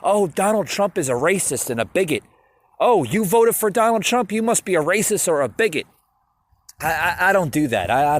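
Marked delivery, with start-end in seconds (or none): 0.0-5.9 raised, louder accusing whine; mock outraged poster. Mocking social media accusers, lightly raised whiny outrage. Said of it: "on social media and I say".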